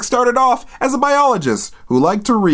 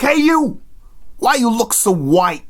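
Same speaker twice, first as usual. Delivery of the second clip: imitated gang member calling out, raised at first; mock street thug; 4 semitones higher than usual. Marauders walk up to Nathaniel, mock street-thug challenge. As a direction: hail him like a cartoon tough.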